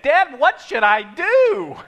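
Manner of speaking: put-on high, slow pleading kid voice; whiny kid. Son asks dad after spilling, plaintive high voice. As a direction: imitate a son asking for help.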